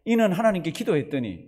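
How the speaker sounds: usual speaking voice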